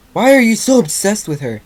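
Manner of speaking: high, loud exasperated outburst; snapping. A narrator's reading. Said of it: "Rainbow Dash snapped".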